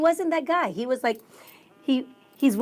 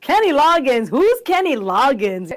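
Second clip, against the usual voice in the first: mock-clueless question, louder at first; joking disbelief; 3 semitones higher, 7 dB louder than usual. Husband joking about the concert invitation, mock-clueless. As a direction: pretend you never heard of him. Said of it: "And he's joking, like".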